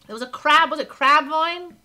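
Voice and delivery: normal talking voice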